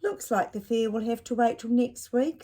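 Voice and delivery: a lightly voiced disappointed father; dad's voice. Dad tells Ned the fair must wait, lightly voiced. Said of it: "said Dad".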